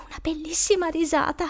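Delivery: high, thin whisper to yourself; anxious whisper. Acted. Trapped boy still hoping it's a joke, anxious whisper. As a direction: whisper it, desperately clinging to hope. Boy's desperate thought in the dark.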